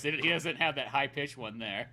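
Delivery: usual speaking voice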